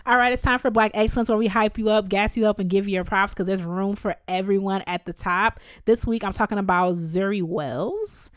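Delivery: loud, upbeat hype intro; segment announcer. Acted.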